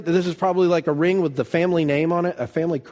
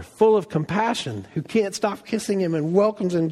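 usual speaking voice

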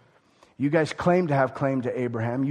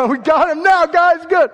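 much higher and louder, triumphant crowing; gloating; 13 semitones higher, 10 dB louder than usual. Acted. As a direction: crow over the win like a gleeful gang.

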